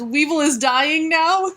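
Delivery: high, drawn-out mock death lament; pitiful dying creature. Poor Weevil to his killer as he dies, mock-pitiful. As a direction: play a slow, pathetic little dying minion. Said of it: "Weevil just says".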